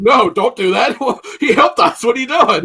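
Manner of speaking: loud, high hammed-up objection with a laugh; alarmed mock protest. Acting out everybody else stopping her killing him, loud mock protest.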